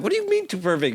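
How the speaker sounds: high, baffled question; can't believe what he heard; incredulous. Retold or imitated. Guitarist stunned by the director's note, high and baffled.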